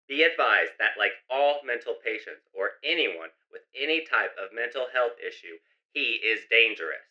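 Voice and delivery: loud, fast emergency radio bulletin; radio announcement. Acted. Radio warning residents about psycho, loud announcer voice.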